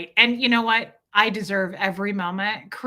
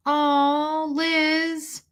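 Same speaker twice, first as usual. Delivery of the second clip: drawn-out, higher-pitched "oh" of condolence; real sympathy; 6 semitones higher than usual. Real, not acted. Streamer hearing of a viewer's friend's death, tender sympathy. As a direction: let the heart go out to them.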